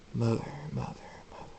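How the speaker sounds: soft, ghostly echo fading away. A narrator's reading. Voice calling Mother inside his head, echoing and fading. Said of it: "It echoes through his head".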